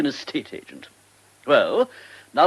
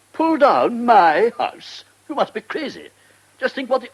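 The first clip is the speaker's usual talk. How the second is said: outraged disbelief; 7 semitones higher, 5 dB louder than usual. Acted. Minister outraged at suggestion.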